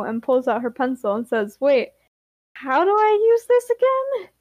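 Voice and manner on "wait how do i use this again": high-pitched, louder bewildered question; puzzled question. Acted. Grape pulls out her pencil, baffled, high puzzled voice. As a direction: ask it high, like you've suddenly forgotten.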